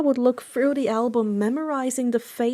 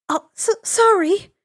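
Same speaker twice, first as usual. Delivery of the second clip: apologetic; 9 semitones higher, 4 dB louder than usual. Acted. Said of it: "Inko apologized".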